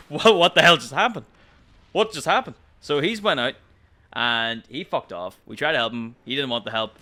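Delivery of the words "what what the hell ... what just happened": louder, higher baffled exclamation; stunned disbelief. Retold or imitated. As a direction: act flabbergasted. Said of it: "We're like".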